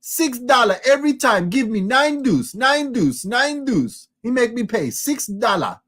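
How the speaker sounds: mock the annoying Jamaican player griping at the dealer; hammed-up Jamaican accent, whiny and shouted; imitating Jamaican lady. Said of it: "this is what she sounds like".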